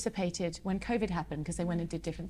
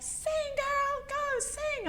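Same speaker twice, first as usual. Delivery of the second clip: much higher, mock cheering fan voice; cheering crowd; 3 dB quieter, much slower than usual. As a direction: call it out like an excited fan in the crowd. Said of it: "the wonderful American audience are like".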